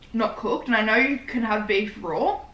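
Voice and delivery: usual speaking voice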